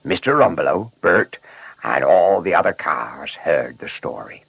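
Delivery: ordinary delivery, nothing marked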